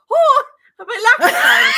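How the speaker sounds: high, loud, laughing mock Irish; Irish accent. Finding her Irish tongue position, shouted mock Irish accent. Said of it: "like, an Irish accent".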